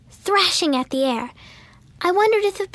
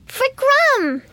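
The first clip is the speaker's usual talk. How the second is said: put-on high, loud child's call; child's cry; 10 semitones higher, 4 dB louder than usual. A narrator's reading. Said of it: "the little gnome cried".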